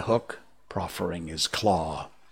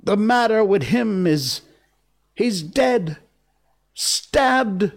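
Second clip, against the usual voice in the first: high, horror-struck report in broken phrases; hollow voice; 12 semitones higher, 5 dB louder, slower than usual. A narrator's reading. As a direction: stop before each dreadful word, pitch way up. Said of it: "replied Cecco in a hollow voice".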